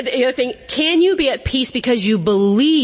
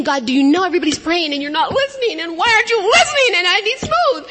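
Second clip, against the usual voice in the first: pleading complaint to God; 8 semitones higher than usual. Retold or imitated. Frustrated prayer during turbulent takeoff.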